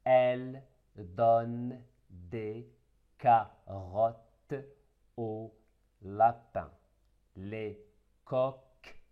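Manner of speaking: slow, each word set apart for pupils; word by word reading. Shown as a demonstration. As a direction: read it word by word to children. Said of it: "Après le découpage".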